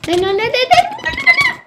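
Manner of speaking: rapid-fire nos climbing into a squeal; high, loud panic, rising sharply to the top; panicked squealing. Real, not acted. Player trying not to lose the game, panicked squealing.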